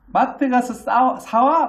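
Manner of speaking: put-on high, loud, slow child's order; bossy kid threatening. His second child bossing dad to go buy it, high and loud. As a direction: drag out the command like a spoiled kid. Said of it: "막 으름장 놓는 거예요".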